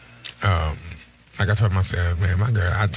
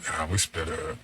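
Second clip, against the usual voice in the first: subdued; 5 semitones lower, 8 dB quieter than usual. Retold or imitated. Friend telling the speaker about his breakup, quietly subdued. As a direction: lower and softer, a touch of sad.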